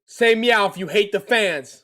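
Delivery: high, loud playful command; loud calling out. Pretend. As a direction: call out to a cat. Calls out to meowing cat.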